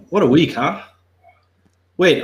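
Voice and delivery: normal talking voice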